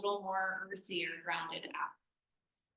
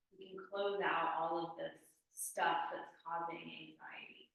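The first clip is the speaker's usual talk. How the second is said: whispering; 3 semitones lower than usual. Acted. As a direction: whisper it gently. Soothing guided relaxation.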